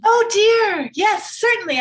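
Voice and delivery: high, loud excited gush; a mother thrilled and caught off guard; breathless eager agreement; flustered delight. Acted.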